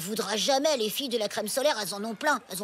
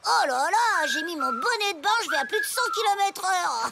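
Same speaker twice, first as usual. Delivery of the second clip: higher, louder mock excitement; mocking imitation; 6 semitones higher, 5 dB louder than usual. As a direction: parody his silly idea. Mocking a friend's idea. (imitation moqueuse)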